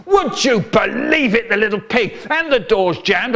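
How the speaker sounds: high, furious outburst; high-pitched angry roar; roaring dad. Acted. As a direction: rage as an exasperated dad. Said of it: "he's roaring".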